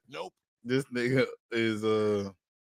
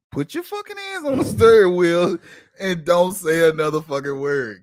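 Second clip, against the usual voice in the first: mock command; 4 semitones higher, 6 dB louder than usual. Replaying his order.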